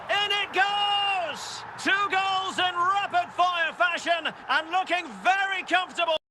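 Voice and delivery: thrilled football commentator; excited goal call. Pretend.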